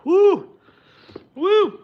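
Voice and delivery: high, loud woo; excited whoop. Real, not acted. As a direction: whoop at the spice. Reaction to spicy soup.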